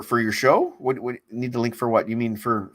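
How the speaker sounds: usual speaking voice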